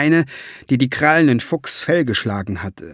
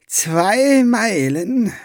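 higher, slow, stunned question; horrified, drawn-out question; alarmed; 8 semitones higher, much slower than usual. Acted. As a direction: ferryman can't believe it's so close. Ferryman alarmed by the news.

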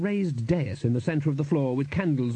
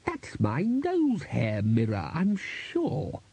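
slow character voice, leaping high mid-phrase; the Bursar's insistence; 4 semitones higher, much slower than usual. A narrator's reading. Bursar claims his mirror, slow swooping insistence. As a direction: draw it out with a big swoop on the claim.

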